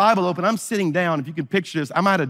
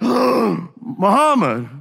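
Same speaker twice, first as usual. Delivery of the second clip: stunned, drawn-out cry of his name; shocked cry; 5 semitones higher, much slower than usual. Retold or imitated. Slapped by his friend, crying out his name in shock.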